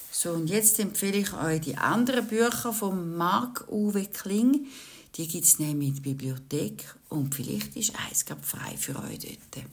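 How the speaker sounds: ordinary delivery, nothing marked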